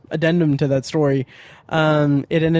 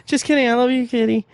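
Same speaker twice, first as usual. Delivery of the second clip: high-pitched cooing pet talk; 9 semitones higher than usual. Real, not acted. Talking to the pet cat in a baby voice. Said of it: "like, a baby voice".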